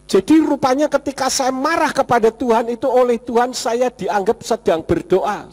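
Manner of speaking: usual speaking voice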